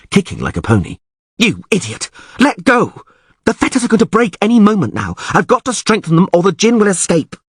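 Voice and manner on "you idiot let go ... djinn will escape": frantic angry shouting. A narrator's reading. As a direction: shout him off before the fetters break. Yennefer struggling and shouting.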